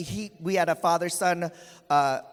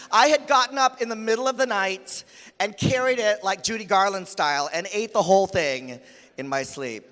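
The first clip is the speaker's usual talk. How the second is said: usual speaking voice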